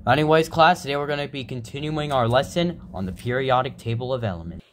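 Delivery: usual speaking voice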